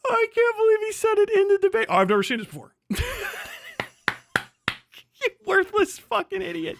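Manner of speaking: normal talking voice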